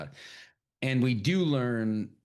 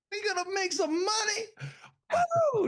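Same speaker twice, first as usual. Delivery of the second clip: very high, crying-out whoop; excited scream. Retold or imitated. Retelling Michael B. Jordan's reaction, very high excited whoop. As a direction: shout it out, full of joy. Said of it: "And he's screaming".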